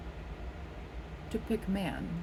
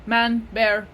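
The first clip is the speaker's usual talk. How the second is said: ordinary delivery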